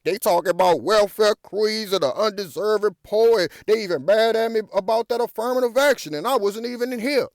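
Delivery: hammed-up pretend Obama, fast and loud; mock Obama plea. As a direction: rattle it off as an imagined president venting. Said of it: "coming out and saying".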